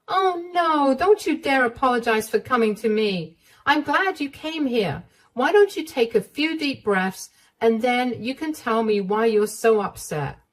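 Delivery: calm her down, coax her to breathe; comforting mother-in-law. Acted.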